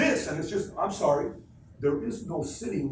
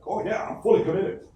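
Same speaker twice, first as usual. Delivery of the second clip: lightly voiced eager recruit, higher and louder; mock committed recruit; 6 semitones higher, 6 dB louder than usual. Speaker mocking a recruit who claims commitment, higher and louder. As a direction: play the overeager recruit a little. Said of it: "Are you".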